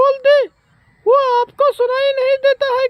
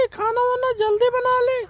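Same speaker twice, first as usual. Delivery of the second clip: usual speaking voice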